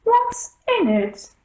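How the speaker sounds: drawn-out haughty demand; the queen of hearts barking at the rabbit. A narrator's reading.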